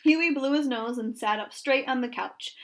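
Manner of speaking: ordinary delivery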